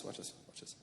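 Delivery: breathy whisper, conspiratorial. Shown as a demonstration. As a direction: drop to a secret whisper.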